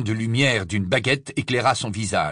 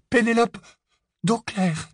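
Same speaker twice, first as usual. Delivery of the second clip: terrified but convincing; 12 semitones higher than usual. A narrator's reading. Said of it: "Sa voix était terrifiée, mais convaincante".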